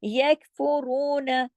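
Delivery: "यकफुरून" slowly, the काफ held firm; slow recitation of "यकफुरून" with firm k; Quran recitation demo. Shown as a demonstration. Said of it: "यक काफ को हमने काफी जमाओं के साथ".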